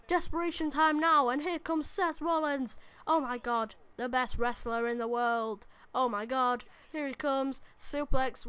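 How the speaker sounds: hyped game commentary over the action; live play-by-play. Acted. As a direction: call the match like a ringside announcer. Live commentary on his own match.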